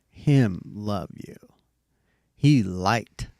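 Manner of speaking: put-on light toddler voice, very slow; a hint of a toddler, drawn out word by word; slow baby talk. Dad retelling his daughter pointing to her room, in slow baby talk. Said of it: "I'm gonna repeat it in baby talk".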